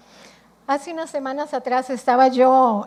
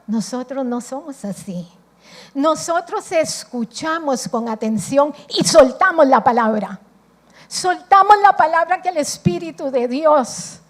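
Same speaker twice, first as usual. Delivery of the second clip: ordinary talk, nothing marked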